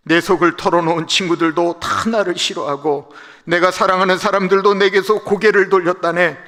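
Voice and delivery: loud, high, anguished lament; humbled plea. Acted. Job pleading with his friends, loud and anguished. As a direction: beg for pity, pride gone. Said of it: "자존심을 내려놓고 하는 말".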